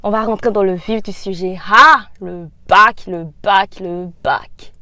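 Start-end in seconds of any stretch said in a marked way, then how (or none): 1.7-4.7 drawn-out exclamation. Real, not acted. Drawn-out sigh naming the exam.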